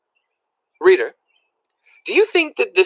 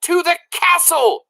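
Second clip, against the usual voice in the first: raised voice repeating; shouting soldier. A narrator's reading. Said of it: "shouted the soldier".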